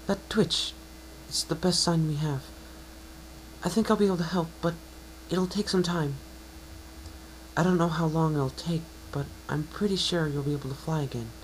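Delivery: high, bright-voiced mare, encouraging; a kind unicorn reassuring her patient; Twilight's voice.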